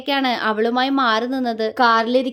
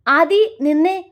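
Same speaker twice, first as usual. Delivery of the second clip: louder, higher call across the yard; boy calling his girl back as she walks off; 4 semitones higher, 4 dB louder, slower than usual. A narrator's reading. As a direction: call her name to stop her. Calling her back from distance.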